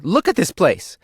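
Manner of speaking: shrill cave wife telling him off; scolding cave wife. A narrator's reading. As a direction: nag the lazy husband. Wife scolding idle husband.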